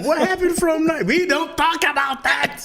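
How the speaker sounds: high, drawn-out mock German protest. Playing a German dodging history questions, mock German.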